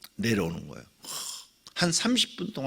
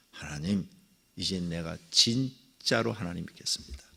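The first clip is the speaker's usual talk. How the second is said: quiet vow; 8 semitones lower, 6 dB quieter than usual. Retold or imitated.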